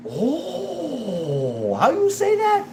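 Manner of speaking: amazed staff voice. Retold or imitated. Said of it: "And they're like".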